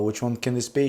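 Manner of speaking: ordinary talk, nothing marked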